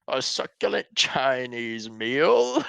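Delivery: booming, drawn-out theatrical quote, rising high at the end; slow, loud, mock-grand exclamation; imitating arrest-video guy. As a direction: boom it out with grand outrage, stretching every word. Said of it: "I don't know why he fucking talks like that".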